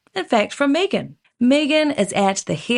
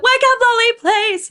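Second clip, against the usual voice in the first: high, raised plea; mock wake-up call; 12 semitones higher, 5 dB louder than usual. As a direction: call out pleadingly to wake a friend.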